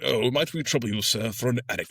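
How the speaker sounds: mock fawning officer begging the captain. Officers sucking up to Captain Aubrey, mock fawning. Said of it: "going like".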